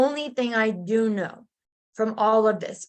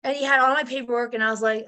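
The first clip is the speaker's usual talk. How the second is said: ordinary talk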